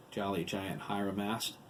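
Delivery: ordinary delivery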